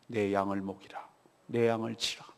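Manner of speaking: low, hushed command; quiet solemn charge. Retold or imitated. Jesus entrusting his sheep to Peter, low and quiet. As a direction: give the charge softly and deep in the voice.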